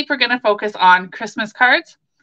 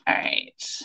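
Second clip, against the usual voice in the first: whispering; 12 dB quieter than usual. Real, not acted. Quiet aside during live demo.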